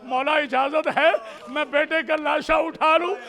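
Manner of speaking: high-pitched pleading lament; father's question delivered in full preaching voice; impassioned plea. Retold or imitated. Same plea repeated as lament.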